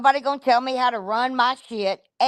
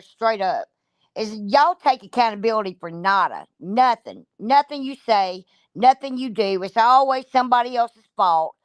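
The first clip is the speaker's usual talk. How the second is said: ordinary talk, nothing marked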